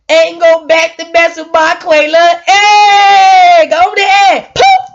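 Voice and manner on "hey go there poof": high shouted hey and a squealed poof; play-fight yell, zapping someone; mock shout casting a spell; pretend fire blast.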